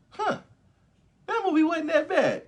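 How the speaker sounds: high, loud, fake-surprised viewer; mock pleasant surprise. Mocking the audience won over by the ending, high mock surprise. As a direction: squeak it out like a fooled fan who is suddenly won over. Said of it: "and then we're like".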